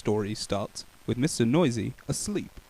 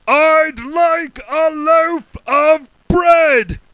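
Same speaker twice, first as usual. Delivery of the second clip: very slow loud high roar; 13 dB louder, much slower than usual. Acted. Mister Noisy roaring at the baker. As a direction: roar each word out. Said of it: "roared Mister Noisy".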